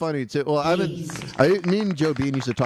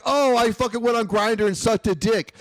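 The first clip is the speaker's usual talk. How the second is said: lightly voiced mocking quote, pitched up; mocking Tanvir; 7 semitones higher than usual. Host mimicking comedian Tanvir's confession, light mocking voice. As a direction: toss off a lightly mimicked brag. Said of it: "He's like".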